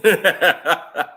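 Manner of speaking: loud chuckling burst; hearty laugh at own joke. Real, not acted.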